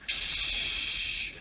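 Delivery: unvoiced shush. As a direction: hush them.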